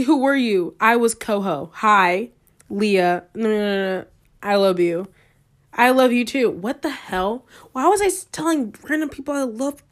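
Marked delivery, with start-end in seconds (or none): none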